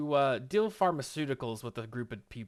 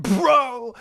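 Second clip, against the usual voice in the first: much louder and higher, yelling in disbelief; shocked outburst; 10 semitones higher, 8 dB louder, slower than usual. Real, not acted. Telling viewers about Steve's story in a loud, shocked yell. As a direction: burst out in stunned outrage, drawing out the words slowly.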